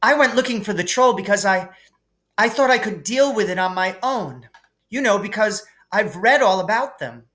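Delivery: high, fast, eager schoolgirl; Hermione's voice. A narrator's reading. Hermione lies to McGonagall to cover for the boys, hurried. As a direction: rush the excuse out like a know-it-all.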